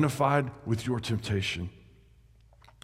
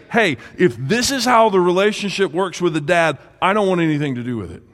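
mock resentful child; 5 semitones higher, 7 dB louder, faster than usual. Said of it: "and say".

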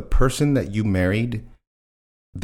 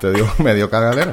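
laughing; 3 semitones higher, 4 dB louder, faster than usual. Real, not acted. Laughing Spanish repeat.

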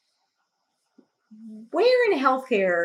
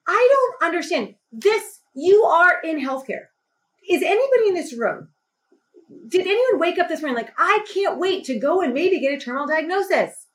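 much higher, raised, slowed outburst; pitched-up distraught protest at the desk; tearful angry plea; 7 semitones higher than usual. Retold or imitated. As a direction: pour out the anger near tears. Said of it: "I start crying 'cause I'm so angry".

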